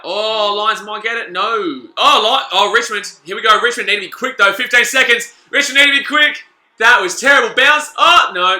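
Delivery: high, loud frantic calling of the play; frantic live call. Real, not acted. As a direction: call the last seconds like a panicked fan. Live tense final seconds.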